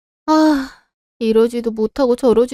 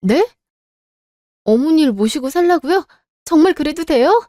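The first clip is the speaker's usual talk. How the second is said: higher, stunned question, lifting on 살라고요; surprised disbelief; 7 semitones higher than usual. Acted. Wife stunned that her mother-in-law lets her mother move in, high surprised question. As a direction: catch the good news in disbelief.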